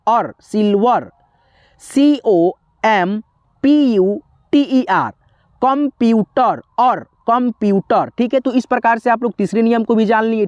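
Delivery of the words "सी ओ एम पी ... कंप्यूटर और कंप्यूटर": spelled slowly, the er ending said as or; slow teacher spelling; slow spelling drill. Shown as a demonstration. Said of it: "उच्चारण कैसे करना है? और में".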